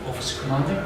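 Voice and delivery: ordinary delivery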